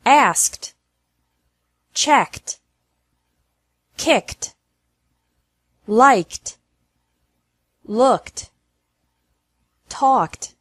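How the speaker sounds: Asked, checked, worked with ED as a crisp T. Shown as a demonstration. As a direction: end kicked and walked on a T sound, no extra syllable. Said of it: "E D is pronounced like T".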